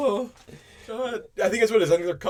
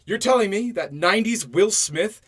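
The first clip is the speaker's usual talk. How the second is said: loud, high "excuse me" disbelief; incredulous; 8 semitones higher, 8 dB louder than usual. Retold or imitated. Podcaster mocking the script, loud and incredulous. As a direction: scoff at the idea out loud.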